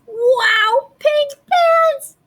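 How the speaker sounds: high, slow, thrilled squeal; delighted squeal. Acted. Boy thrilled with his new pink shoes, high gush.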